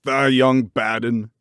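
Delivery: slow, gruff scolding call; old man scolding a girl from a ladder; angry old gardener. A narrator's reading. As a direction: drag the insult out. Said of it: "he called down at her".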